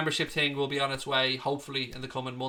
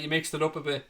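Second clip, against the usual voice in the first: normal talking voice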